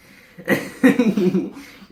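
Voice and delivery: loud high laugh breaking out; burst of laughter. Real, not acted. Reading fanfic aloud, bursts out laughing. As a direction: crack up at the line.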